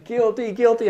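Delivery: high, loud hammed-up guilty guilty; mock guilty cry. Caught student hamming up his guilt to the priest.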